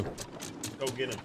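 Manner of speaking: softly plotting to go after a solo player; quiet scheming. Real, not acted. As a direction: murmur the plan to yourself.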